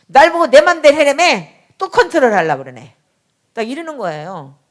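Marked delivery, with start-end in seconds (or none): 0.1-2.9 higher and louder, indignant and exasperated; indignant outburst. Retold or imitated. Daughter protesting to her mother that she is being controlled again, in a loud indignant outburst. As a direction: fire it back like a teen caught being controlled.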